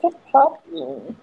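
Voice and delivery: high, slow cooing at the puppy. Real, not acted. Streamer sees friend's new puppy, high cooing.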